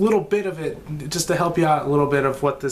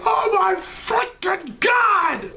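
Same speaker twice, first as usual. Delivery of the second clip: very high, slow drawn-out shriek; excited shriek; much slower than usual. Retold or imitated. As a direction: squeal it like a thrilled fan. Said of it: "I was like".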